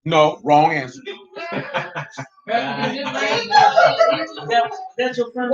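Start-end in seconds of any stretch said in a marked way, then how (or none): 1.3-2.2 quick voiceless laugh; short airy chuckle; breathy laugh. Real, not acted. Class gives wrong answer. 2.5-5.5 words broken by laughter; high squeak into a laugh. Real, not acted. Laughing at wrong answer.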